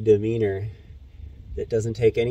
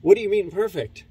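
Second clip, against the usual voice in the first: fast, higher, louder disbelief; incredulous; 7 semitones higher, 5 dB louder, faster than usual. Retold or imitated. Narrator confronting friend about land, fast and incredulous. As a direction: demand an explanation, stunned. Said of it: "And I'm like".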